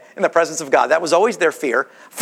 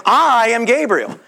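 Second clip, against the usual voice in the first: "I" drawn out long and higher in pitch; stress on I; 5 semitones higher, slower than usual. Acted. Angel answers Zechariah with his name, stressed "I". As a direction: lean hard and slowly on "I". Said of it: "His I is emphasized as well in his sentence".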